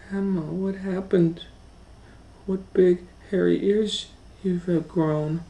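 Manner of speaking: put-on a wide-eyed little girl asking.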